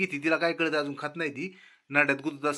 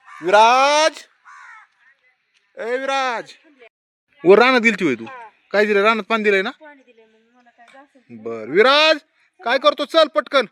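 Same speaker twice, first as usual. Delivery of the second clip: much louder, higher call across the field; 9 semitones higher, 9 dB louder than usual. Real, not acted. Father calling son Viraj back from the field, loud.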